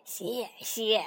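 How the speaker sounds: grandpa toad chuckles, then thanks; low, pleased old laugh; happy chuckle. A narrator's reading. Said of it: "蛤蟆爷爷开心地笑了".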